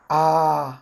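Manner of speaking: आ held long and level; long vowel. Shown as a demonstration. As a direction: draw आ out as a long "aa". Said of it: "that is pronounced आ".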